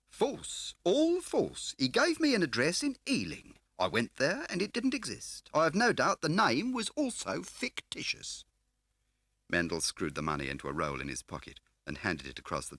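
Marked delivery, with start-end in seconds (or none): 0.1-8.4 smarmy dealer playing grand; oily fat car dealer. A narrator's reading. Scar's voice held.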